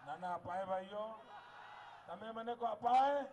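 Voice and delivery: rally shout. Real, not acted. Speaker calling on the crowd to answer, loud.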